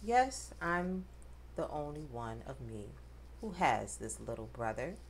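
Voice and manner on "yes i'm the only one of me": plain reading voice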